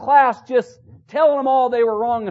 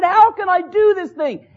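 high, raised-voice retold protest; impassioned question; 9 semitones higher, 5 dB louder than usual. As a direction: cry the question out from the pulpit. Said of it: "And he said".

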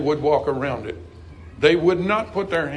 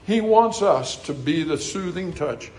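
ordinary talk, nothing marked

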